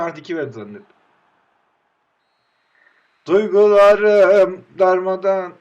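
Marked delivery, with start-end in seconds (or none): 3.3-5.5 mock lament. Sad joke line.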